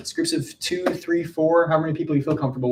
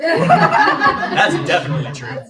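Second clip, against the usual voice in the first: laughing; 11 semitones higher, 8 dB louder, slower than usual. Real, not acted. Laughing agreement.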